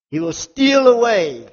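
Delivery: slow, loud, high declaration; forceful preaching. Real, not acted. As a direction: stretch it out as a warning to the congregation.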